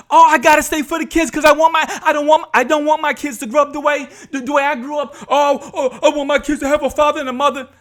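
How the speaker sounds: high, fast mock pleading; mock excuse-making. Mimicking parents who stay for the kids, mock earnest.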